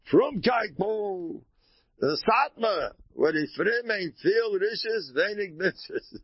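slow Yiddish-inflected imitation of the Satmar elders; a Yiddish-accented rebbe, slow and sing-song; Yiddish-accented impression. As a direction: drag it out in a Yiddish old-world accent. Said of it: "they all spoke like this".

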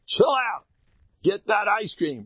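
urging. Acted. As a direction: push them to lighten up. Loud urging after whisper.

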